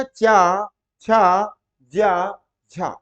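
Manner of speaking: चा, छा, जा, झा loud at first, long vowels; letter demonstration. Shown as a demonstration. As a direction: sound out each syllable for students. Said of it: "बोल करके आपने क्या देखा".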